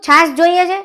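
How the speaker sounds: scolding her mother-in-law, a sharp high snap; sharp scolding question. Acted. Daughter-in-law scolding old mother.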